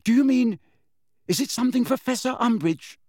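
put-on high, tentative girlish question; timid pupil. A narrator's reading. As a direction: venture a delicate guess. Timid pupil questions upset teacher.

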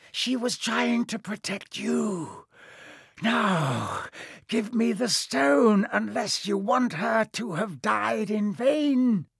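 high, slow, sinister voice; hissing villain. A narrator's reading. The face taunts Harry and demands the stone, sinister. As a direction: taunt him high and drawn-out, building to a demand. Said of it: "it hissed".

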